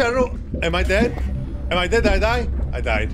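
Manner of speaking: ordinary talk, nothing marked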